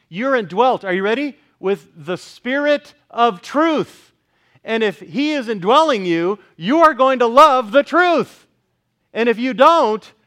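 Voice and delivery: preacher hammering the point home to the congregation; fiery preaching. Acted. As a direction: build it up from the pulpit. Preacher rising to full force.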